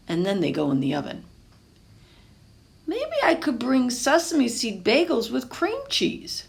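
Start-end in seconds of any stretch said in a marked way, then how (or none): 2.9-6.4 put-on higher young boy voice, musing; a kid weighing his choices aloud; hopeful boy. Pablo suggesting sesame bagels to Papa, hopeful boy voice.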